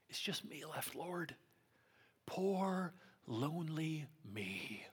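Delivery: hammed-up poor-me lament; mock self-pity. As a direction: sigh it out as a pitiful lament. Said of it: "this place of depression".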